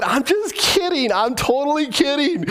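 high-pitched playful backtracking; hammed-up insistence; acted protest. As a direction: plead that it was a joke, pitch up. Acted hasty backtracking to Rachel.

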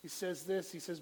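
usual speaking voice

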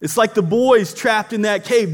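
normal talking voice

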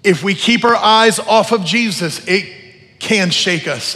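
fervent, loud call to surrender; impassioned preaching. Real, not acted. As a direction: preach it at full force, phrase by phrase. Preaches at full force.

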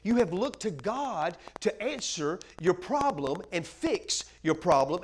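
ordinary delivery

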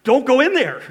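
loud, high warning call; mock yell at the screen. Preacher yelling at a horror-film character. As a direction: shout a warning at a horror movie. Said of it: "I'm wanting to yell out to the person on the screen".

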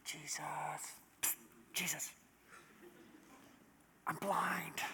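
the quiet plea they did not make; timid whisper. Retold or imitated. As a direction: barely whisper it, as if too shy to ask. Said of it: "They're not going".

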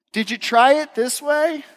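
high, drawn-out sing-song question; chirpy unhelpful helper. Acted. Bystander butting in on a frustrating task, sing-song mock helpful.

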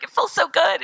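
high-pitched, imitated swoon of pleasure; gushing delight. Parade-goer feeling nostalgic, gushing delight. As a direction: gush over how lovely it all is.

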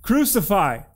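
high, loud crowd chant; shouted. A narrator's reading. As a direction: cry out like a mob demanding a sentence. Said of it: "they shouted".

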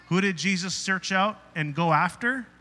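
ordinary delivery

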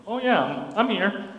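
ordinary talk, nothing marked

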